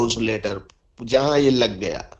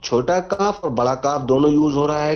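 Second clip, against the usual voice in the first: usual speaking voice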